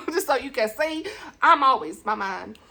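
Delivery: ordinary talk, nothing marked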